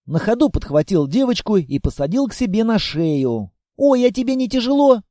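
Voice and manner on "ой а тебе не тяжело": put-on higher, quick child's voice; bright quick question from a small girl; chirpy little girl. Girl on the monster's shoulders, quick chirpy question.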